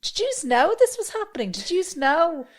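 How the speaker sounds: high-pitched mock disbelief at classmates. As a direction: squeak out a mock accusation. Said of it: "you're like".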